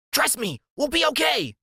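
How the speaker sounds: high eager reassurance; Freak's high voice. A narrator's reading. Sidekick urging his friend into the pond, high and eager. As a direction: promise it high and bright.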